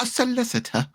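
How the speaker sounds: high teasing echo of solicitor; mock high voice. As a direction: pitch way up, parroting the fancy word. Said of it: "I'm just saying it how they say it".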